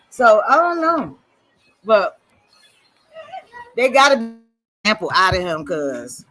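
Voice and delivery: ordinary delivery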